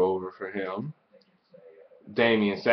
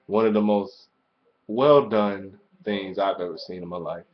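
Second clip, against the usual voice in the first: ordinary talk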